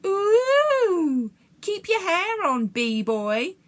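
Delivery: bully mocking Jack's outburst. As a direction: taunt him like a playground bully.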